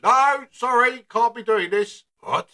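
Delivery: usual speaking voice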